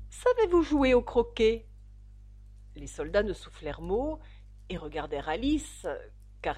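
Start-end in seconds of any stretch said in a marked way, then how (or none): 0.1-1.7 loud, high demand; shouted question. A narrator's reading. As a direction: call out the question imperiously. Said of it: "cria la reine". (question lancée d'une voix forte)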